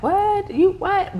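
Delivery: high-pitched, incredulous mock reaction; mimicked shock. People hearing her money goals, mock shock. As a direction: imitate someone stunned, pitch shooting up.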